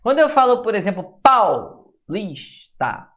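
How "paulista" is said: Paulista drawn out slowly so the diphthong is clear; slow demo word. Shown as a demonstration. Teacher showing students the diphthong, slow and clear. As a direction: stretch Paulista syllable by syllable. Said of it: "o A é mais forte".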